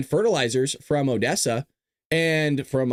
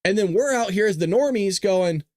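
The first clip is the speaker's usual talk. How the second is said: normal talking voice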